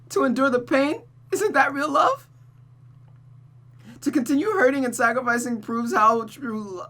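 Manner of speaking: high, tearful, pleading confession; wounded girl defending her love; crying daughter's lament. Acted. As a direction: pour out the pain, half sobbing. Rosa's long lament.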